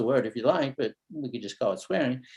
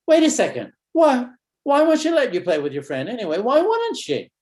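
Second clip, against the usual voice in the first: sudden, much higher and louder puzzled question; storytelling surprise; 11 semitones higher, 6 dB louder than usual. Shown as a demonstration. Therapist building suspense for the kid, surprised. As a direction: act struck by a new thought. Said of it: "notice the storytelling".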